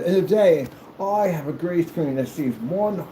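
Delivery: slow, pompous mock proclamation; hammed-up old-time orator; noble scholar. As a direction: announce it like a learned gentleman. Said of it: "bunch of noble scholarmen talking about things".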